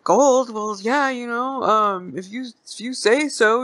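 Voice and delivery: put-on high-pitched, hesitant old man; old dude. Old dude playing along with the stranger, high hesitant voice. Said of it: "the old dude's like".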